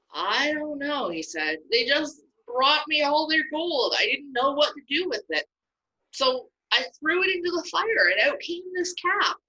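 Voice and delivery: high, whiny, squirming voice; guilty excuses. Acted. Aaron excusing himself to Moses, guiltily whining. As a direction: weasel out of the blame.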